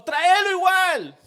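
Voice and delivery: emphatic command. Retold or imitated. Pastor insists to the father, loud and slow. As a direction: hammer home "tráelo igual".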